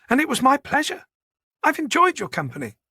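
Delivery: high, polite ladylike voice; high-voiced genteel woman. Manager thanking Boyd for his company, politely genteel. Charlotte politely says goodbye.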